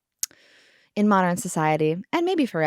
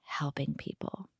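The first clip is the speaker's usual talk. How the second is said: mock earnest lawmaker; 4 semitones lower, 8 dB quieter, slower than usual. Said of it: "the person who wrote those laws was like".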